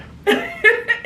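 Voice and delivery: high, loud burst of delighted laughter. Real, not acted. Thrilled about her thrift find, a high bright laugh.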